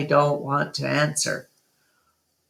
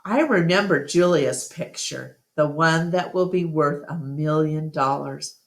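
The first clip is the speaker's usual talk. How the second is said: usual speaking voice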